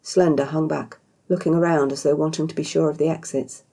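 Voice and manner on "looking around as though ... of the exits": ordinary delivery, nothing marked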